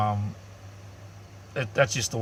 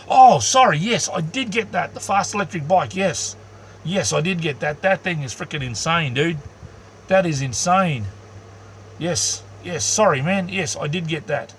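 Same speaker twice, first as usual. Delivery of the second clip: high, eager, bursting with enthusiasm; suddenly thrilled to remember; real excitement, much higher and louder; excited recognition; 6 semitones higher, 4 dB louder than usual. Real, not acted. Suddenly remembers viewer's bike email.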